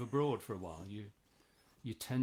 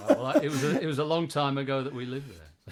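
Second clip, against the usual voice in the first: laughing; 3 semitones higher, 11 dB louder than usual. Real, not acted. Laughing at the joke.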